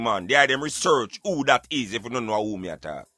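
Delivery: normal talking voice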